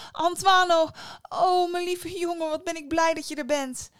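high, loud, gushing welcome; overjoyed mother. Acted. Mother greeting her son at the house, overjoyed. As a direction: gush with relief at a returning child.

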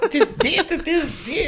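very high-pitched imitation of a protesting listener; playful high mock outcry; mock exasperated listener. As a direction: squeal it as if correcting a podcast. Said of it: "mensen naar hun telefoon aan het schreeuwen".

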